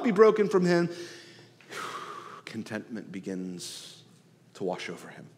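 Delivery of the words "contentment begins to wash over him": soft sighing. Acted. Sighed, soft reflective line.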